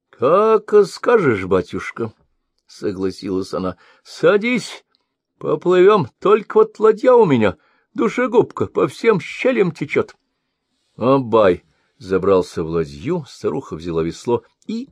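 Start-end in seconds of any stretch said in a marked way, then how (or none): 0.1-2.1 put-on high, cackly-toned old crone, peering and suspicious; old woman voice. A narrator's reading. Old woman questions the sorcerer, high suspicious crone voice. 4.0-10.1 put-on high, cackly-toned old crone, peering and suspicious; old woman voice. A narrator's reading. Old woman questions the sorcerer, high suspicious crone voice.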